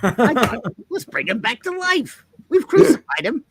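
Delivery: high, excited showman pitch; hammed-up huckster selling a wild idea; Stan Lee. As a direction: swoop up high like a self-delighted promoter. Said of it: "Stan Lee, I present Resurrection".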